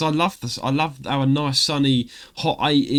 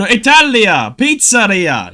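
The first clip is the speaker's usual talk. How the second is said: hammed-up Italian accent, Italia and pizzeria rolled out high and loud; mock Italian accent; 6 semitones higher, 9 dB louder, slower than usual. To the post office clerk, a mock Italian accent. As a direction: play a cartoon Italian, loud and sing-song. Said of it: "You should have done that accent".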